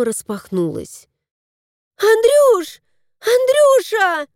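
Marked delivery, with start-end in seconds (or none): 2.0-4.3 much higher, louder call from the doorway. A narrator's reading. Tanya calling her husband from the entrance, high and loud. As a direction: call husband from the front door. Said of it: "послышался Танин голос".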